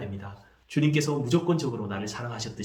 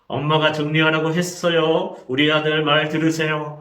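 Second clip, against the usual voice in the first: acted mother holding back anger, louder; through gritted teeth; 2 semitones higher, 6 dB louder than usual. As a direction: force polite words through gritted teeth. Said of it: "이를 물면서 말합니다". (이를 악물고 화를 참는 엄마 흉내)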